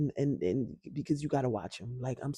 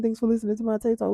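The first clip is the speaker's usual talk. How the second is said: much higher, louder and fast; mocking repeat; 8 semitones higher, 5 dB louder, faster than usual. Shown as a demonstration. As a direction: toss the line back up high as a self-tease. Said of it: "keep saying".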